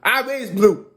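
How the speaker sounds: rising high and loud; indignant, building up; heated protest. Retold or imitated. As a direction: insist she earned her say. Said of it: "fire said".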